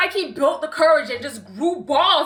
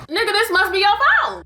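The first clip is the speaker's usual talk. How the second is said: calling out; 7 semitones higher than usual. Retold or imitated. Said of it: "'Cause I'm like".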